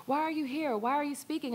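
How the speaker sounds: put-on high, light child voice asking her mom; little daughter's voice. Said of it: "She was like".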